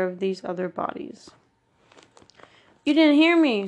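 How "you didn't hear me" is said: angry raised voice, high and loud; fuming. A narrator's reading. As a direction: fume it out like a bully demanding an answer. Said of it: "Smurf fumed".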